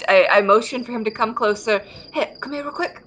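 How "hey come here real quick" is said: hushed call. Acted. Calling NPC closer quietly.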